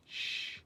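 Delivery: a whispered "Sh" with the tongue right behind the top teeth; English sh sound. Shown as a demonstration. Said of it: "you notice where your tongue falls when you say sh".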